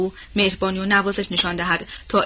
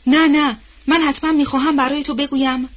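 higher-pitched, insistent protest; hurried, urgent speech; 6 semitones higher, 5 dB louder than usual. A narrator's reading. Wife afraid her husband will silence her, urgently insisting. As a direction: burst in with a raised, pressing voice.

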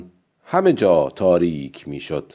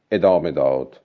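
ordinary delivery

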